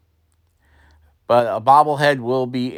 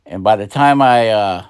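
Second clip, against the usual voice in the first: usual speaking voice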